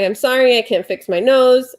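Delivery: ordinary talk, nothing marked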